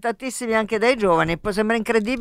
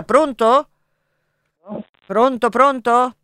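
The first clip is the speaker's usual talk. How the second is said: louder, slow hello into the line; calling down the phone line; 3 semitones higher, 5 dB louder, slower than usual. Real, not acted. Host calling out to caller.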